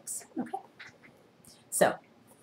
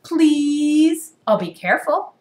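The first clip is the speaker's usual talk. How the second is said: pigeon pleading; 9 semitones higher, 8 dB louder, slower than usual. Acted. Pigeon begging to drive.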